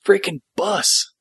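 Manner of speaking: high-pitched mock outburst; imitated snapping. Podcaster retells Chuck's line at the scene's end, imitating him snapping. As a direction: build to a high, drawn-out exasperated finish. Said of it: "at the very end he snaps".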